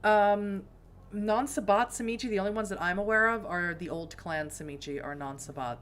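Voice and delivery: normal talking voice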